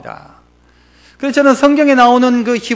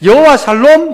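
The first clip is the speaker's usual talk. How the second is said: ordinary talk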